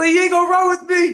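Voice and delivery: high, fast, indignant protest; incredulous protest. Retold or imitated. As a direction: call him out in disbelief.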